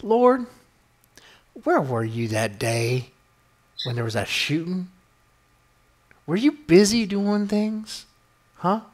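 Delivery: hammed-up preacher calling out to the Lord, slow drawl; mock-preach a reproach at God, Southern style; mock televangelist. Host imagining himself on televangelist shows, hammed-up mock sermon. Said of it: "talk about Jesus with this voice".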